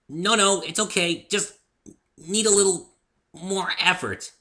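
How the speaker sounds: high, loud, slowing with effort; cheerful but straining; chipper Pinkie voice. A narrator's reading.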